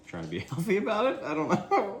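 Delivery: laughing. Real, not acted. Laughing at own drink choice.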